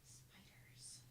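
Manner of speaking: breathless whisper; spooky whisper. Real, not acted. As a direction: whisper it like a threat. Ominous mention of Australian spiders.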